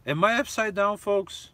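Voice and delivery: high, loud disbelieving question; can you believe this, folks; real bewildered appeal to the audience; incredulous. Real, not acted.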